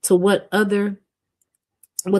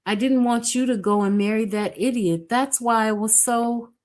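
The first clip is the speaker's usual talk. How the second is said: put-on higher, flustered girl's voice; a young woman tumbling over her own excuses; upset young woman; 6 semitones higher than usual. Edna answering, upset, apologetic.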